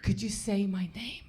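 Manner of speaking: mock-intimate whisper; hushed plea. Comedian begging a man to say her name, hushed whisper. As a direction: whisper the request as if begging a secret favour. Pleading with a stranger for French name.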